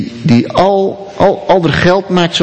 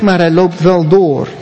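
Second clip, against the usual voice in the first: ordinary talk, nothing marked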